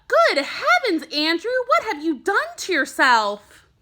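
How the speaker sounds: a startled teacher gasping at her pupil; shocked teacher. A narrator's reading. Teacher shocked at blue freckles.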